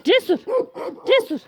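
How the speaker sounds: high, loud repeated name calls; calling a dog. Real, not acted. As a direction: call the dog's name again and again. (köpeğin adını yüksek sesle tekrar tekrar çağırma)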